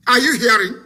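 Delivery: usual speaking voice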